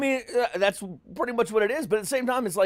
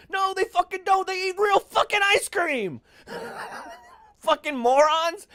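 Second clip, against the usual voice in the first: heated rant; 11 semitones higher than usual. Real, not acted. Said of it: "You're really passionate about that fucking ice cream".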